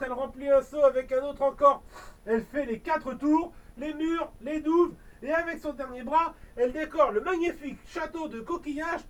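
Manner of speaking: plain reading voice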